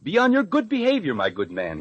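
haughty aristocrat. Retold or imitated. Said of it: "he says to me".

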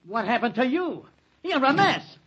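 loud, startled cry; shocked exclamation. Acted. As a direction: react aghast at how he looks. Shocked at friend's battered arrival.

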